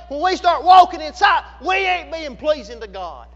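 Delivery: preacher building to a shout; preacher tells congregation they're asking God in faith, building to a shout; full-force preaching. Real, not acted. Preach it hard, rising on each phrase.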